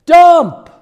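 sudden high shout; yelling. Retold or imitated. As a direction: bark it out from the back. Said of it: "he kept yelling out the word".

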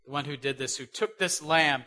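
ordinary delivery

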